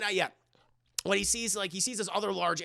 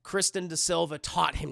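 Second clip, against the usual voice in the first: normal talking voice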